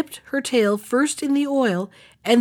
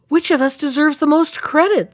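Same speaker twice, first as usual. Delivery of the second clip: crying out; 6 semitones higher, 4 dB louder than usual. Acted. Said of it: "they all cried at once".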